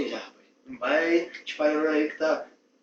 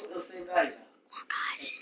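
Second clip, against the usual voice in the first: hushed, unvoiced question; whispering; 5 dB quieter, much slower than usual. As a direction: drop to a whisper. Boss asks about the water.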